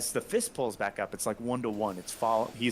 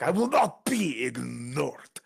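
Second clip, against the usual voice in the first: slow, loud, furious goliath; goliath demands attention, slow angry roar; 3 semitones higher, 3 dB louder, slower than usual. Acted. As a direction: bellow it out like an offended giant. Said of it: "gets pissed at this point".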